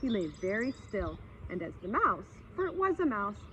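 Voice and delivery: ordinary delivery, nothing marked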